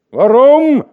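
loud, high call from below; shouted question. A narrator's reading. A worker below shouting back at the reeve. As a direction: yell it up in protest. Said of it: "schrie eine Stimme von unten herauf".

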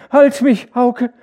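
very high, very loud feverish scream; screaming to Hauke. A narrator's reading. Feverish wife screams for her husband, shrieking. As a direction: shriek for your husband to hold you. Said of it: "schrie sie".

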